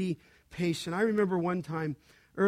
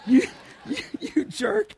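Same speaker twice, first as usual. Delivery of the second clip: high, teary laugh breaking on "jerk"; laughing through tears; 8 semitones higher, 5 dB louder than usual. Real, not acted. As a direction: choke up while laughing fondly. Laughing, choking up about Scott.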